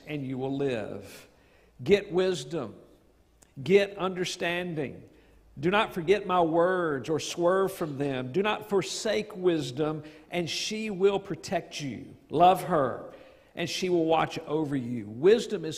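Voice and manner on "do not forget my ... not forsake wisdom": usual speaking voice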